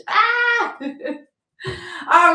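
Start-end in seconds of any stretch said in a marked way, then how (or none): 0.1-0.8 high, happy little squeal; real squeal of delight, pitched way up; excited squeal. Real, not acted. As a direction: let out a thrilled squeal. Excited squeal over tips.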